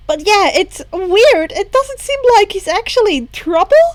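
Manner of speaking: put-on light bubbly girl voice, lifted; lightly voiced bubbly girl.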